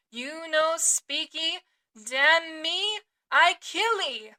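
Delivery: slow, loud broken-English threat; threatening cannibal. Acted. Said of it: "And so saying".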